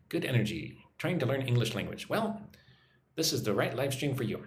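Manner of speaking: ordinary delivery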